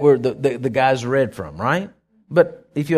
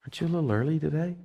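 sheep's question; 9 dB quieter, much faster than usual. Retold or imitated. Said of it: "they're all looking at me like".

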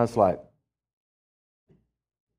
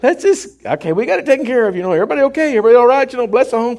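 high, loud and laughing, calming the crowd; excited reassurance; 11 semitones higher, 6 dB louder, faster than usual. Retold or imitated. Preacher tells the household the demon is gone, excited and laughing. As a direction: laugh it out, relieved and keyed up. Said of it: "I said".

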